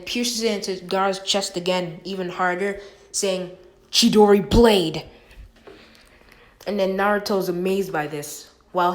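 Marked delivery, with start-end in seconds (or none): 3.9-5.0 loud battle cry, attack name; shouted attack name. Acted. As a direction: yell the technique as you strike. Attack name called out in fight.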